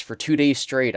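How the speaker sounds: ordinary talk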